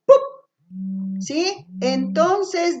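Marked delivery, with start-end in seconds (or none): 0.1-1.2 "pup" as a short popped p, lips only; isolated p sound. Shown as a demonstration. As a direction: pop the p of "pup" as a bare sound. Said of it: "cambio por el sonido".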